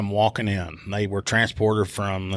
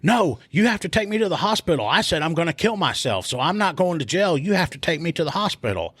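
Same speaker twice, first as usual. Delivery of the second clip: pleading; 7 semitones higher than usual. Retold or imitated. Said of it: "he starts telling me".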